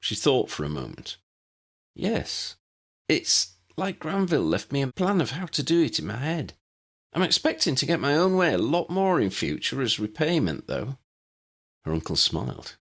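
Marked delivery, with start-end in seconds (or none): 2.0-11.0 put-on girl's voice. Lydia's lines voiced higher.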